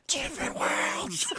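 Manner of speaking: a loud, drawn-out different worlds; loud then suddenly hushed. Shown as a demonstration. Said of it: "I have to whisper louder".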